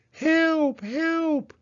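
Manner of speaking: high, loud cry for help; a panicked hedgehog calling out. A narrator's reading. Hedgehog swept down the rapids, high panicked cries. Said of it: "Hedgehog cried out".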